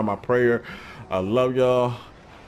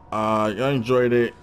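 ordinary talk, nothing marked

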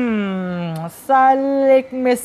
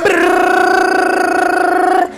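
shivery brrr; 7 semitones higher, 4 dB louder than usual. Acted. Shivering washing faces.

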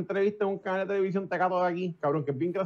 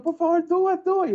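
begging; 11 semitones higher, 4 dB louder than usual. Retold or imitated. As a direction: plead with him to say it. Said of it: "Y yo". (suplicando con insistencia)

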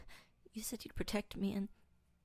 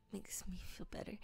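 whisper; 2 semitones lower, 10 dB quieter than usual. Acted. Whispered ASMR roleplay.

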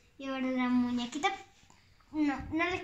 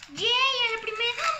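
loud, high victory cheer; excited cheer; 6 semitones higher, 9 dB louder than usual. Real, not acted. Girl unboxing cheers at guessing right, thrilled cheer. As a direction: shout it out, thrilled to get it first try.

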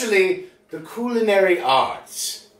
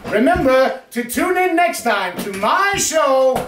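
normal talking voice

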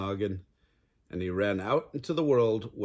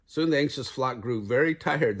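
ordinary delivery, nothing marked

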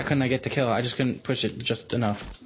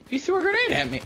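disbelief; faster than usual. Real, not acted. Laughing disbelief at teammate.

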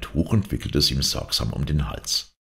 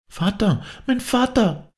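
put-on much higher, pleading child's cry; little girl wailing after her father; pitiful child crying out. A narrator's reading. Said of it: "schrie eine klägliche Kinderstimme".

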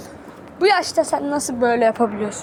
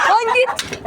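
high sharp yell; yelling at the rooster to get lost; shooing yell; 10 semitones higher, 3 dB louder than usual. Real, not acted. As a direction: shoo it off hard.

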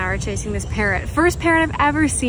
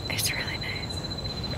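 soft sleepy whisper; 16 dB quieter, slower than usual. Real, not acted. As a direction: hush it at bedtime. Quiet night, partner falling asleep.

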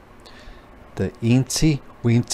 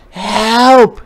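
loud high "Help!"; yell for help; 11 semitones higher, 8 dB louder than usual. A narrator's reading. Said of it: "yelled the spider".

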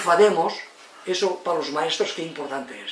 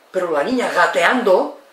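ordinary delivery

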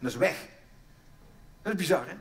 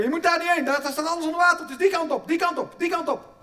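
mock panicked shout; 9 semitones higher, 6 dB louder than usual. Inner human shouting directions in flood.

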